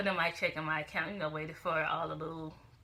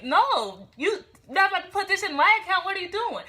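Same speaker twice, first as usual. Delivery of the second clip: high, loud, acted-out objection; indignant protest; 12 semitones higher, 11 dB louder than usual. Woman stopping a stranger using her account, indignant. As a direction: act out stopping him, voice shooting up.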